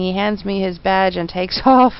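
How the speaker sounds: normal talking voice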